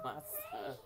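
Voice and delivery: a high, breathy laugh on the words; laughing through it. Real, not acted. Mother laughing through tears about her son. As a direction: laugh it out, choked up.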